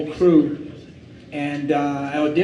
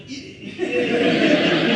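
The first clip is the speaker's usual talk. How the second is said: Spike Lee impression; 7 semitones higher, much slower than usual. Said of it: "Spike's in the corner going".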